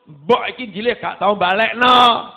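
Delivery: loud, slow, resentful griping; grumbling complaint. Retold or imitated. Child complaining about mother's borrowing, loud and slow.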